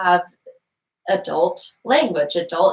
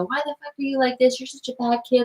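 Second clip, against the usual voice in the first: mock angry parent; 6 semitones higher than usual. Parent voiced scolding child.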